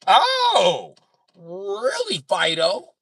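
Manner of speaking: loud, high, drawn-out mock outrage; mock indignant comeback. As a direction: fire back in loud, exaggerated disbelief. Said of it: "I'm gonna be like".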